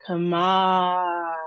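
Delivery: "Camar-" with a long held "mah"; second-syllable stress. Shown as a demonstration. Teacher starting the word for learners, slow stressed second syllable. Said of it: "the second syllable".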